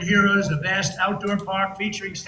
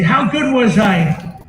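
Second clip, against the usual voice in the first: slow, loud proud question; bragging; 8 dB louder, much slower than usual. Retold or imitated. Bragging to his wife about the show, slow and loud. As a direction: fish for praise, drawn out. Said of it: "I brag a little bit".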